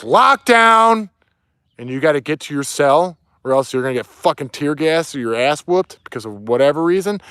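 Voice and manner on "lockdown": shouted command, high and loud; officer's yell. Retold or imitated. Corrections officer calling lockdown to inmates, loud shout. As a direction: bark it like a prison guard. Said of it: "corrections officer yells".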